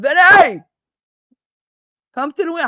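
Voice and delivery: high, loud holler up to a window; calling out. Retold or imitated. Friend outside a walk-up calling Vinny, high yell. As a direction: yell the name up four floors, pitch soaring.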